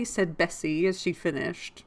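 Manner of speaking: ordinary delivery, nothing marked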